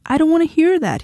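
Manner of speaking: high-pitched protesting cry; anguished shout. A narrator's reading. Husband covering his ears to his wife, shouted. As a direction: cry out in refusal, pitch jumping up. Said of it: "he shouted".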